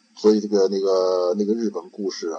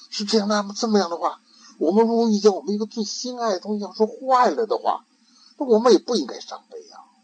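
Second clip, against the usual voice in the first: put-on high, bright boyish questioning; child's voice; 11 semitones higher than usual. Ikkyu leading his master on, in a high child voice. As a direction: play a clever little monk.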